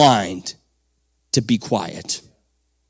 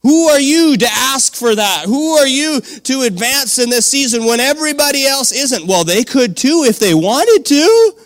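raised, ringing preacher's call to praise; preaching at full force; 7 semitones higher, 4 dB louder than usual. Acted. Preacher rallying the men, higher and louder. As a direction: fire up the congregation.

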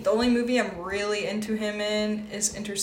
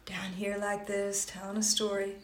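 low storytelling tone; 7 dB quieter than usual. Retold or imitated. Listener imitates the narrator's calm storytelling tone. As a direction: ease into a quiet, even story voice. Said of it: "at one tone where he's like".

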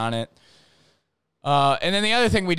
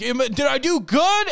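much higher, eager puppy-like voice; eager dumb jock; 12 semitones higher, 5 dB louder than usual. Retold or imitated. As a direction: beg for praise like a clueless kid. Kid asks if he did well.

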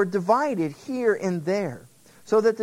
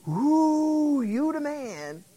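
mock admiring crowd; 9 semitones higher, much slower than usual. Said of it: "everyone would go".

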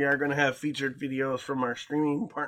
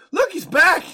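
excited call; 10 dB louder than usual. Real, not acted. Cohost returns with ice cream.

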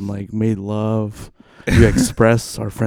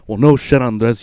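ordinary talk

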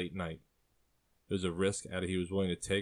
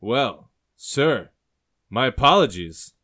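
loud, high apology with swooping tune; over-polite barkeep backing off, word by word; apologetic barkeep; 4 semitones higher, 12 dB louder, slower than usual. A narrator's reading. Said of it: "the barkeep says".

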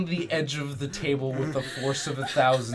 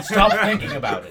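laughing warning; 7 semitones higher, 10 dB louder, faster than usual. Real, not acted.

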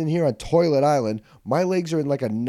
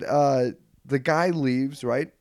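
ordinary delivery

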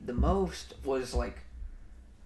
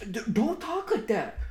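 flustered scolding; 7 semitones higher than usual. Retold or imitated. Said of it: "and I'm like".

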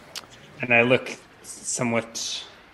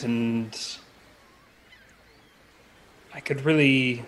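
lightly posh stranger in a tavern; a touch of polite, lofty adventurer; genteel traveler voice; 2 semitones higher, slower than usual. Acted. Player voices his character in-scene.

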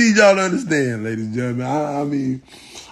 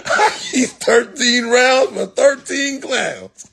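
laughing punchline; 7 semitones higher, slower than usual. Shown as a demonstration. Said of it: "repeated punchline".

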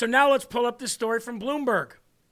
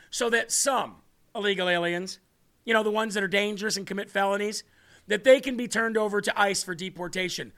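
ordinary talk

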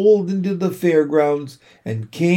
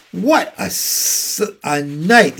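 slow, drawn-out hoarse exclamation; hoarse repeat; 4 semitones higher, slower than usual. A narrator's reading. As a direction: savour it in a slightly hoarse voice. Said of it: "he repeated hoarsely".

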